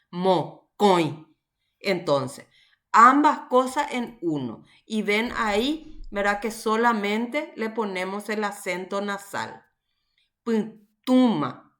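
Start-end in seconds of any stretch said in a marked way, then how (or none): 0.1-1.3 "mocoy" with a stressed, nasalised O; nasal stressed O. Shown as a demonstration. Teacher illustrating the nasal accent, "mocoy" with nasal stressed O. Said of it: "la pronunciación de la O nasal". 10.4-11.7 "Puntuma" slowly, stressing a nasal U in "tu"; nasal stressed U. Shown as a demonstration. Teacher giving another example, slow "Puntuma" with nasal stressed U. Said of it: "fuerza de pronunciación en la U".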